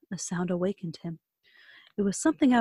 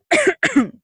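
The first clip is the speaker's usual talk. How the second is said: sore throat cough; 14 semitones higher, 9 dB louder than usual. Real, not acted. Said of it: "I have a sore throat coming on".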